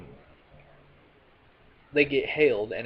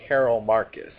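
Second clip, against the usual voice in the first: Carol Marcus said louder, firmly fixing a slip; loud correction; 7 dB louder than usual. Real, not acted. Catching her own slip about Carol Marcus, louder. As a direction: correct yourself with a firm push on Carol Marcus. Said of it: "Did I really say that?".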